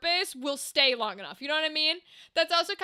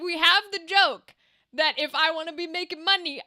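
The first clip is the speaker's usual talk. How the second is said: usual speaking voice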